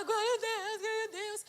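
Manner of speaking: imitated weepy high voice; tearful praise. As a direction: wail thanks to God in mock sobs. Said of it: "Comecei a chorar".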